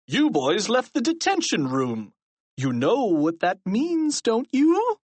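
menacingly gleeful accusation; gloating accusation. A narrator's reading. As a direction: corner them, rising high at the end. Principal gloats at caught boys.

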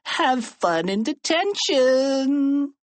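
high, slow sing-song gloat; smug taunt. A narrator's reading. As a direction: draw it out high and smugly. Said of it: "grinning smugly".

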